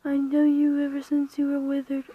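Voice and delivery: normal talking voice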